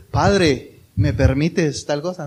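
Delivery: formal address. Acted. Son stiffly asking father's permission, mock formal. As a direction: ask Dad as if he were an official. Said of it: "normalmente uno no, no le dice".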